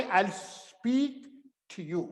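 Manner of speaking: I'll speak to you with a rise on speak; teaching demo. Shown as a demonstration. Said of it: "क्या बोलेंगे?".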